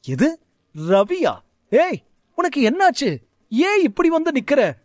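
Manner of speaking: rattled, demand what happened; a shaken teacher questioning his hurt student; alarmed. Acted. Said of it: "சற்று பதட்டத்துடன் சாரதி கேட்டான்".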